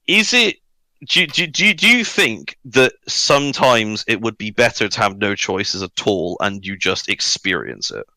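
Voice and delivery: ordinary talk, nothing marked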